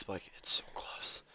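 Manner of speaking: whispered aside. Real, not acted. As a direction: whisper it under breath. Unvoiced aside.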